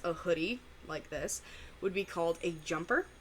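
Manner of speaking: normal talking voice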